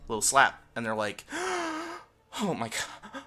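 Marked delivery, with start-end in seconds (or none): 1.3-3.2 hammed-up scandalized onlooker; mock horror. Mimicking people appalled at a dog slap, mock horror.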